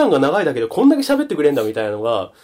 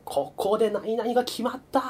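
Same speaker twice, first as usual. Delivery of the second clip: slow, higher, a lightly voiced commentator; game announcer; 8 semitones higher, 7 dB quieter than usual. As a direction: call it like a hushed sportscaster. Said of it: "実況が入ってた". (実況アナウンサー風にゆっくり)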